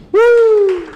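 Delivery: high, loud whoop; cheer. Real, not acted. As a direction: cheer her onto the stage. Host cheers the act on.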